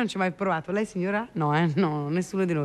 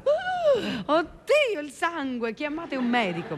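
mock panicked shriek; 7 semitones higher, slower than usual. TV host mimics a squeamish doctor, mock panicked shriek. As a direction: play the fainting doctor's horrified cry. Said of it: "abbia urlato".

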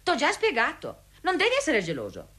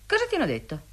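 ordinary delivery

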